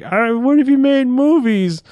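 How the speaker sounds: high, slow mock wonder; mock naive fan. Fan writer dreaming of making movies, high slow mock wonder. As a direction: play a starry-eyed fan writer.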